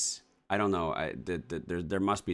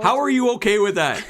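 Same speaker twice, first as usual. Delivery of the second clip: much higher, louder, squealing disbelief; shrill outraged shriek; 12 dB louder than usual. Real, not acted. Outraged at partner's calm.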